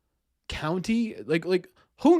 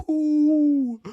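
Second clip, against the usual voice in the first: high, loud "ooh"; excited whoop; 11 semitones higher, 5 dB louder than usual. Real, not acted. Streamer getting a quiz answer right, excited whoop.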